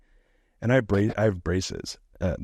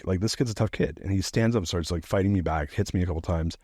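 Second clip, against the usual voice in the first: ordinary talk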